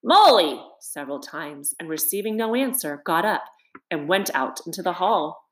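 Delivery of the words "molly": loud call into the house. A narrator's reading. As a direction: call out for your friend. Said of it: "Then he called".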